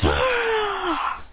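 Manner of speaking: high, drawn-out mock "aah"; imitated battle cry. Acting out the fighter's cry.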